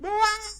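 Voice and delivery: sudden very high loud scream, cut short; falling scream. Retold or imitated. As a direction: yell as if falling off a building. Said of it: "Like that".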